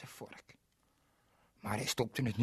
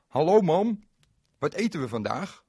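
put-on high, loud boyish hello; cheerful boy; 6 semitones higher, 9 dB louder than usual. Berend greets his mother, high and bright. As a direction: call out like a hungry boy.

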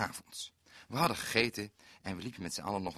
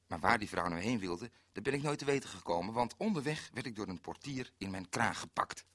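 ordinary talk, nothing marked

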